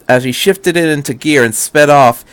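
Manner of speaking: ordinary delivery, nothing marked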